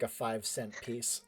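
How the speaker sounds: ordinary delivery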